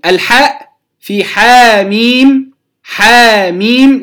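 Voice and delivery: حاميم with a long drawn ā, said slowly as one word; correct Ḥāmīm. Shown as a demonstration. Teacher modeling Quran letters for students, slow drawn demo. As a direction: draw out حاميم slowly, Ḥā joined to Mīm. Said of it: "لا نقول حاء ميم".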